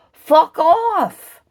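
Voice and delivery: yelled fuck off; shouted dismissal. Real, not acted. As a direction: blast them away.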